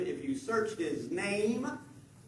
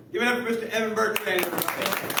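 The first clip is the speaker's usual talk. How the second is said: host announcement; 3 semitones higher, much faster than usual. Acted. Host intro.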